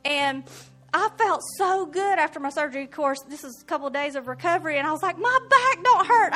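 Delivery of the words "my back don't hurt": high, loud amazed exclaim; stunned with happy relief; amazed joy. Retold or imitated. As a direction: burst out amazed. Retold joy after surgery.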